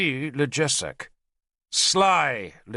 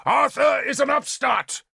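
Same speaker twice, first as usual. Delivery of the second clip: raised high and loud; shouting; 13 semitones higher, 3 dB louder than usual. A narrator's reading. King to the roaring hall, shouting. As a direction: bellow it to the whole hall. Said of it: "Gorfethid shouted".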